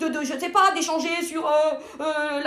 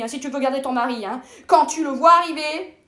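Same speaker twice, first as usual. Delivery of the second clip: normal talking voice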